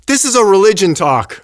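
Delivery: retold outburst, pitched well up; imitating an angry doctor. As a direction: stand up and protest like an offended doctor. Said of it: "He was, like, angry".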